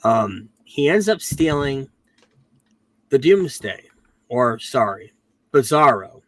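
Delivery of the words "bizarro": usual speaking voice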